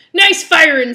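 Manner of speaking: rally the crew as a rough pirate. Acted. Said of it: "doing this pirate voice".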